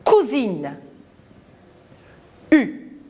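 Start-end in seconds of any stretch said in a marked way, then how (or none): 0.0-0.8 Cousine with the S voiced as z; pronounce z. Shown as a demonstration. Teacher modelling Cousine with a z sound. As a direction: say Cousine with a clear z between the vowels. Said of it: "S entre la voyelle U et la voyelle I se prononce ze".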